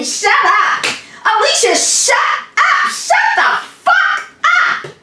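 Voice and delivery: very high, loud screamed shut up; losing it and screaming at the aunt; screaming back. Retold or imitated. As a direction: scream it at her at the top of your lungs. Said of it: "I just started screaming".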